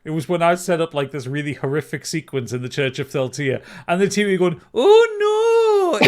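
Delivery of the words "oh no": very high laughing Scottish cry of alarm; Scottish accent. Retold or imitated. Retelling the TV's reaction in a hammed-up Scottish accent. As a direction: squeal it like a panicked Scot. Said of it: "in this Scottish accent".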